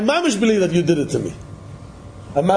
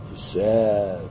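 low, soft mock-sad voice; a touch of sadness, low and soft; 9 semitones lower, 4 dB quieter than usual. Acting out sad reaction.

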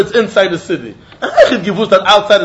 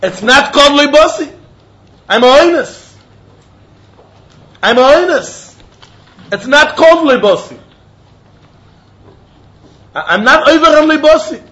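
higher, raised insistent call; mock yell; 5 semitones higher, 3 dB louder than usual. Man yelling his excuse to the rabbi, raised mock yell. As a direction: insist you are forced. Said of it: "The Iker yell that he has to yell is".

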